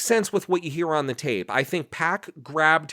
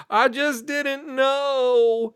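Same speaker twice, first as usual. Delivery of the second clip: high, drawn-out fake weeping; mock tearful; 11 semitones higher, much slower than usual. Mocking the wife crying for the camera, hammed-up tearful. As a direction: put on a wailing plea, long and high. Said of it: "throwing the tears on".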